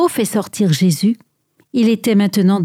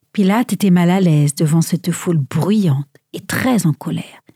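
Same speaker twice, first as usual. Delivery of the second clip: usual speaking voice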